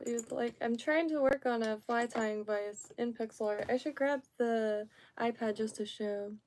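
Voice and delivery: usual speaking voice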